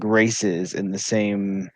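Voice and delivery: ordinary talk, nothing marked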